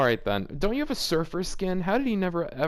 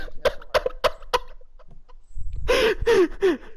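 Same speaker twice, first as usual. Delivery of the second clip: high cackle. Real, not acted. Laughs at sex line.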